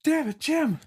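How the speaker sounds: high, loud battlefield cry; panicked soldier shout. Acted. Soldier yelling to his comrades that Jim's head got booped off, mock panic. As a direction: shout the bad news to the squad, hammed-up. Acts out soldier reacting to sniper.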